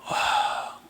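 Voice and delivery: ordinary delivery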